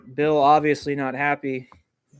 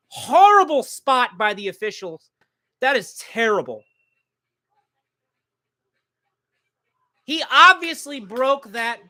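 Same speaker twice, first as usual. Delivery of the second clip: much higher, louder furious protest; outraged rant; 9 semitones higher, 5 dB louder than usual. Real, not acted. Fan ranting at the officials over a bad spot, outraged and loud. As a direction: let the outrage boil over at the refs.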